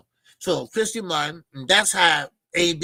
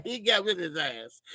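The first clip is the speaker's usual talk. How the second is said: normal talking voice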